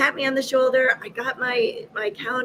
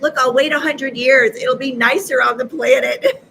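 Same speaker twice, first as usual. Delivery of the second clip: louder, raised bargaining voice; mock pleading; 3 semitones higher, 7 dB louder than usual. She bargains with God to wait, mock pleading. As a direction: haggle insistently, half laughing.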